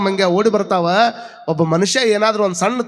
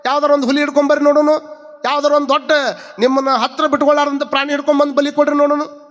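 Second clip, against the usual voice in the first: challenging; 8 semitones higher, faster than usual. Acted. Preacher voices Basavanna challenging sacrificers.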